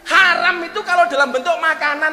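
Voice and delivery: high, loud sermon delivery; rousing pulpit preaching; fiery preaching. Acted. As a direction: preach it at full force to the crowd. Preaching at full force.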